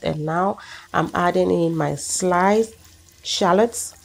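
ordinary talk

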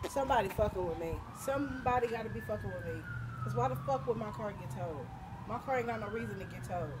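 low, soft, quick grumbling; subdued real frustration; upset disbelief. Real, not acted. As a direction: complain under your breath, working it out. Upset, suspecting someone towed car.

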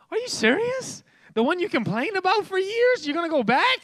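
can't believe what you're hearing; mock outraged are you serious; incredulous disbelief.

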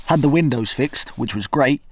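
ordinary talk, nothing marked

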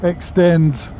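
muffled behind helmet chin bar; speaking while riding motorcycle; inside a motorcycle helmet. Real, not acted. Seen 4: Rider wears closed full-face helmet throughout; reference frames show only cap.

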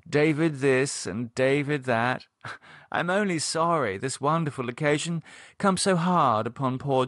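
gushing socialite, slowing into sympathy; gushing Cynthia. Acted. Cynthia greeting bereaved David, gushing sympathy. Cynthia gushes, then condoles.

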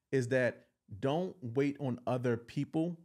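ordinary talk

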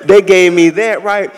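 ordinary delivery

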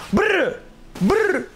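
high lip-trill engine noise; engine brr. Pretend. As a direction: trill your lips like a motor.

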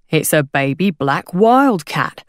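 slow rubbery salesman voice; insistent hawker. A narrator's reading. Hawker defending his fake wildcat, slow and insistent. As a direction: stretch out the sales claim.

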